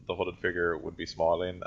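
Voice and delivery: ordinary talk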